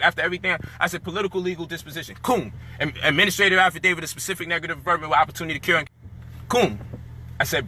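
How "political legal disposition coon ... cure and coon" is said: rattled-off legal terms. Retold or imitated. Retells his words and replies.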